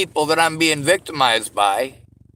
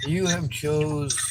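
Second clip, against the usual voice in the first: game show voice imitation; 6 dB quieter, slower than usual. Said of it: "sound like a game show".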